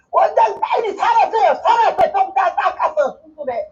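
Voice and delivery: high, loud urgent call to the director; 다급한 외침; shouting. Retold or imitated. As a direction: yell for the doctor to operate now.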